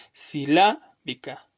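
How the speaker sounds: "silábica" drawn out, si-LÁ, then -bica; stress on LÁ. Shown as a demonstration. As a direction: slowly lean on LÁ in "silábica". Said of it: "según la pronunciación".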